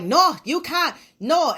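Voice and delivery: a lightly voiced counter clerk refusing; mock counter guy. Hair store clerk blocking her at the door, mock stern. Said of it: "was like".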